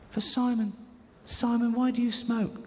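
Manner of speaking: put-on high, slow childlike question; little girl. Little girl asking Simon about smoking, high slow childlike. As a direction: ask it like an earnest little girl.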